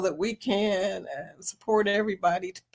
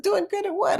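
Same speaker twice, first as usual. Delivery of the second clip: Doing good at what asked higher, quizzical; puzzled question; 11 semitones higher than usual. Retold or imitated. Host retelling her confusion at a chat comment, puzzled. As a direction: act baffled by the comment. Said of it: "I said".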